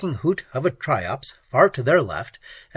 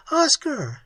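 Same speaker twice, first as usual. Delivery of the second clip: cried name; 12 semitones higher than usual. A narrator's reading. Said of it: "she cried".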